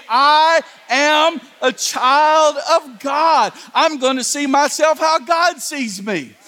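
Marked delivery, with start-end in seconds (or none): 0.1-3.5 a preacher's slow chant for the crowd to echo; declared slowly. Shown as a demonstration. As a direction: proclaim each word, drawn out. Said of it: "Houston, say it with me".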